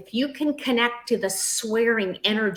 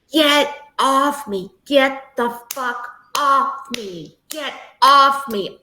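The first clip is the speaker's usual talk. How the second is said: slow, louder mock shout pushing an attacker away; retold shouting; 3 semitones higher, 6 dB louder, slower than usual. Survivor at the retreat finally yells at her abuser, slow loud shout. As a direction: shout it out, slowly and hard. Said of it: "And all she said".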